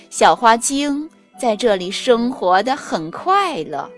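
ordinary delivery, nothing marked

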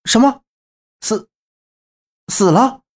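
high, stunned stammer; startled high-pitched questioning; shocked. A narrator's reading. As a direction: blurt it out in disbelief. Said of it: "周震先是一惊".

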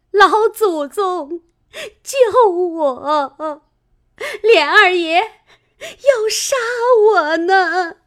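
high, loud, slow wail for help; cling and cry for rescue; terrified plea. A narrator's reading. Fengjie begs the matriarch to save her, high and wailing. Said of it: "只说".

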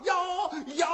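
sharp high shout for luck; frantic cry. Acted. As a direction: cry out hoping for the dice. Gambler cries for a one.

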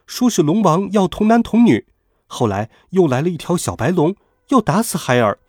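put-on a child's high voice pleading his case; high childish voice explaining himself. Nezha explaining to his father in a high child's voice.

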